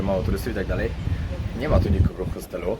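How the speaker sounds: normal talking voice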